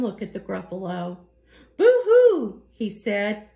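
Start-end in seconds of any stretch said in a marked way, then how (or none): 1.8-2.6 very high, very loud mock boo-hoo sob; a hammed-up frightened owl bawling; shrieked pretend boo-hoo; owl wail. Owl sees gruffalo, wails.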